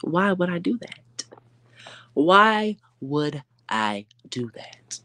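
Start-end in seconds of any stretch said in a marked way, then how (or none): 2.1-5.0 very slow, every word stretched out; drawn-out taunt. Real, not acted. Vlogger mocking a rival creator, slow drawn-out taunt. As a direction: drag each word of the question out to mock her.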